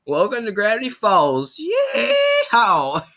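put-on high-pitched excitable old-timer; Southern holler building to a shrieked yeehaw; Old Man McGuggin. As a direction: whoop it like a crazy old coot. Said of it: "Old Man McGuggin".

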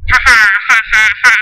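low, rapid villain cackle; evil laugh. Acted. Villain gloating over an evil plan, evil laugh.

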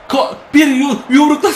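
a sudden high yell as the cross comes in; excited shout. Real, not acted. Goalkeeper yelling as he punches the cross clear. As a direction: shout the save in a panic.

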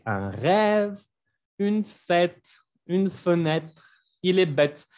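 "rêve", "fête", "fenêtre", "bête" each with open è. Shown as a demonstration. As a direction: list the examples, landing on each open ê. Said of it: "Ese sonido de E abierto". ("rêve, fête, fenêtre, bête" con è abierta)

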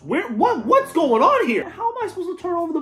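outraged. Acted. Coach ranting about missing booster money, high indignant outrage. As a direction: demand answers like a furious coach.

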